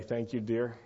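ordinary delivery

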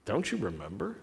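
soft, hushed repeat of the question; quiet echo. Acted. Preacher turning the question on the congregation, hushed. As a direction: let it drop to a near hush.

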